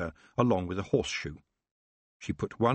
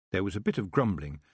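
usual speaking voice

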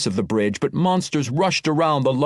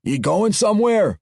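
a higher, questioning dwarf brogue; dwarf soldier; 8 semitones higher than usual. Acted. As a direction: ask the leader in dwarf brogue. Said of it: "one of the dwarves asked".

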